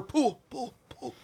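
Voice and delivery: fading echo. Pretend. Music Man quote, echo fade.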